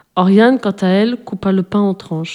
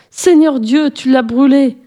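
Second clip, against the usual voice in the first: higher, startled cry over the blouse; exclaiming in dismay; 6 semitones higher, slower than usual. A narrator's reading. Madame Ducorneau finds her blouse burnt, crying out. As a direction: cry out as she sees the burn. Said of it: "s'écria Madame Ducorneau".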